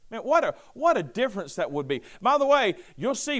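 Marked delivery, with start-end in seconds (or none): none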